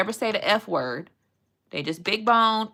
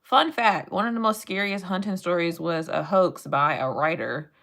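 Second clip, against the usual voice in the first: normal talking voice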